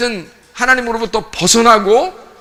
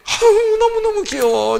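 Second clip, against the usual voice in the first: high-pitched gushing coo; doting parent; 11 semitones higher than usual. Acted. Parent cooing over a naughty child, high doting gush.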